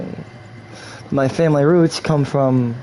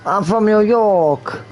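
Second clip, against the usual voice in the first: New York accent; 6 semitones higher, slower than usual. Shown as a demonstration. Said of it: "the Brooklyn tongue is like this".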